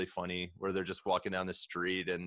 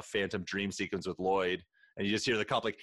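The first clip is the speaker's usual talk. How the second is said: ordinary talk, nothing marked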